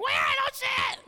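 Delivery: put-on very high, shouted little-girl voice; loud toddler daughter. Daughter answering dad about the rabbit, loud toddler shriek. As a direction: shriek it like a baffled two-year-old. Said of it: "of course, she's loud".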